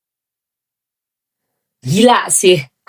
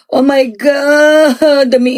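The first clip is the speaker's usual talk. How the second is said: awed cry; 4 semitones higher, much slower than usual. Real, not acted.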